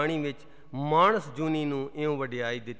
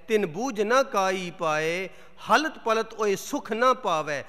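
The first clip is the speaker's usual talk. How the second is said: measured Gurbani verse reading with long pauses; solemn, deliberate recital of sacred couplets; scripture recitation; 2 semitones higher than usual. Acted. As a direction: declaim each phrase, letting the line endings fall.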